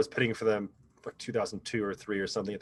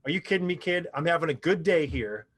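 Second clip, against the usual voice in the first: mock angry boss; 5 semitones higher, 3 dB louder than usual. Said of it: "he turns to me and he goes".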